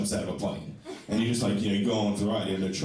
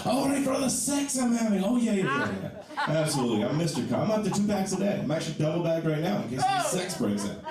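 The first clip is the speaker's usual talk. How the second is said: sarcastic; 7 semitones higher than usual. Retold or imitated. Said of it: "I'm like".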